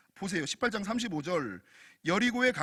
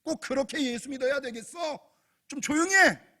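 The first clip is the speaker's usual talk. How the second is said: irritated shushing with loud bursts; playing an annoyed crowd telling someone off; acted scolding; 4 semitones higher, 7 dB louder than usual. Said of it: "그를 꾸짖어 잠잠하라".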